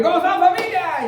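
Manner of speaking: high, sing-song imitation of Mari arriving; mock cheerful greeting. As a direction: play the sister-in-law breezing in, voice way up. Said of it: "entró Mari". (saludo burlón muy agudo)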